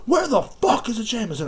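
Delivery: high-pitched mock yell; imitated scream. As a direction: mimic a rude customer hollering. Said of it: "she screams this".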